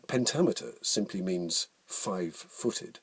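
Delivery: normal talking voice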